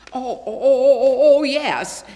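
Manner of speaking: high, loud, flustered oh-oh-ohs; flustered stammer. Acted. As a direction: cover your slip in a hurry. Jimmy caught out about Santa.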